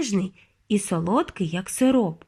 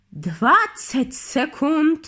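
very slow, higher witch call; 4 semitones higher, much slower than usual. A narrator's reading. Grand High Witch calls out the countdown to the witches, drawn out. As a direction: draw out each word gleefully. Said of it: "крикнула верховна відьма".

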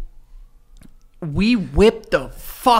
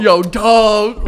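loud, raised, stretched judge catchphrase; Randy; 4 semitones higher, 9 dB louder than usual. Retold or imitated. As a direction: holler it like a hyped TV judge. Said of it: "cut out the Randy impression".

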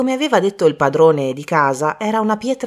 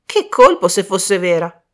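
excited exclamation; 5 dB louder than usual. Acted. Michael imagines the stone is real, excitedly exclaiming. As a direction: exclaim at the thought of a windfall. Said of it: "esclamò Michael".

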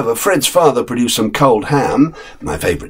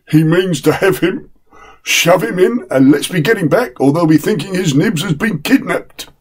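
grinning chauffeur; 9 semitones higher than usual. Acted. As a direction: give in with a grin. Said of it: "said the chauffeur, grinning".

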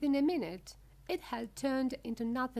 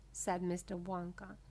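plain reading voice

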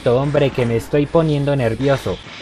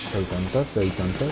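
much softer and lower, muttered to himself; quiet self-scolding; 6 semitones lower, 8 dB quieter than usual. Real, not acted. Player blaming himself for a game mistake, quiet self-scolding. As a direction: grumble at your own blunder.

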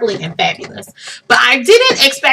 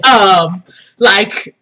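usual speaking voice

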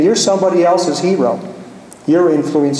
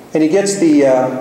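plain reading voice

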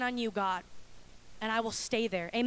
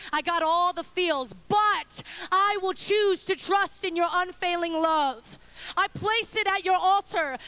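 loud, high, passionate preaching; building sermon climax, louder and higher; preaching at full force; 4 semitones higher, 4 dB louder than usual. Acted. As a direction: lift the room with conviction.

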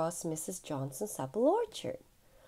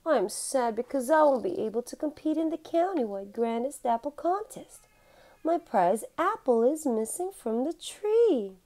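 lightly voiced sad Mrs Johnson, a bit higher; a tearful neighbour telling her loss; sad old lady; 6 semitones higher than usual.

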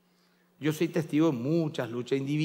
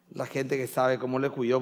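normal talking voice

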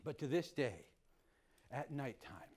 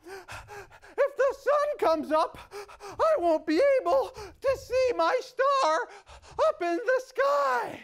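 worried coyote; 8 dB louder than usual. Acted. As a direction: fret about losing the star. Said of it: "And the coyote thought".

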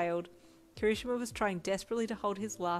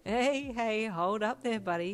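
cocky, teasing call, a bit higher and louder; smirking; 3 semitones higher, 4 dB louder, slower than usual. Acted. Kaminari stops his friend at the door, smirking. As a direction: block the way with a grin in your voice. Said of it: "he said with a smirk".

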